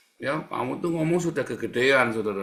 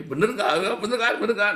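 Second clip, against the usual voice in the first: high, eager, louder questioning; seeking self-justification; 9 semitones higher, 5 dB louder than usual. Shown as a demonstration. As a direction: fish insistently for agreement. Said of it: "kamu sedang mencari pembenaran". (mendesak cari pembenaran)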